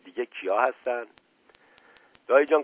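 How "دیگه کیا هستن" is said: plain reading voice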